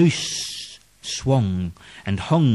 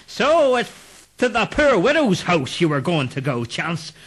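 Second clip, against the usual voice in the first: mocking ghost; 7 semitones higher than usual. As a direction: jeer at him. Headless men mock Clancy.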